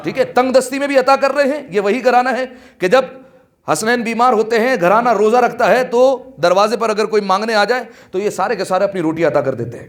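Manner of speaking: preacher stirring the mourners to praise the household's generosity, fervently; fervent preaching. Acted. Full-force preaching.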